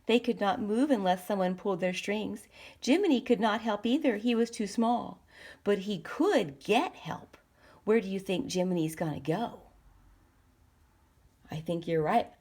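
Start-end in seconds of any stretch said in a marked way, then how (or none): none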